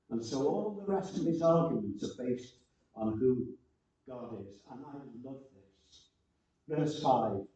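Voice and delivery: ordinary delivery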